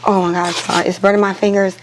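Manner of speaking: normal talking voice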